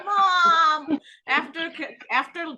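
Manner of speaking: loud, high whine to mom; mock protest. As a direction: call out in exasperation, drawn out. Retold protest to teasing mother.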